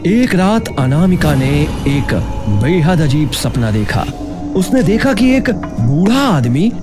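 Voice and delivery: ordinary delivery, nothing marked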